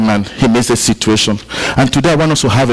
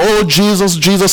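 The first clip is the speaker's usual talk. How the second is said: higher, eager call of a crowd; mock crowd scream; 7 semitones higher than usual. Preacher voicing the crowd calling to Jesus, mock scream. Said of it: "Some people are screaming".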